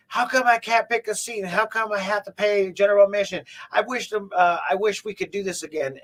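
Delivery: mock the caller's whining; a listener griping about seating in a high mock whine; mimicking upset customer. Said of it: "they're like".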